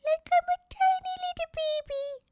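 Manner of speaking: put-on extremely high, tiny squeaky baby voice; little baby voice. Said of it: "I'm talking like a little baby".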